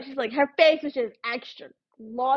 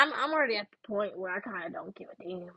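whispered aside; 3 semitones lower, 9 dB quieter than usual. Real, not acted. Confessing mean thought to viewers.

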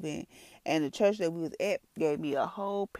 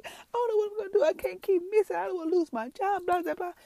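high, fast whiny rant; mock complaining; 13 semitones higher than usual. Woman retelling her worries about losing her job, mock complaining. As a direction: imitate yourself griping in a high-pitched rush. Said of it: "I was complaining, complaining, complaining".

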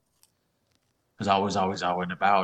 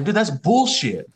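high, loud, drawn-out objection; slow, raised-pitch outburst; indignant protest; 9 semitones higher, 6 dB louder, slower than usual. Retold or imitated. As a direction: act out a fed-up teenager. Teen protesting mother's decision.

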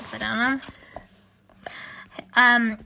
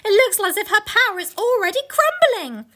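high, exultant storybook voice; acted jubilant excitement; delighted cry; 8 semitones higher, 4 dB louder than usual. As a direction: crow over good news, nearly dancing. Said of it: "almost dancing with delight".

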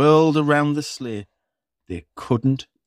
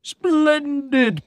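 booming Santa. Acted. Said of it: "boomed Santa".

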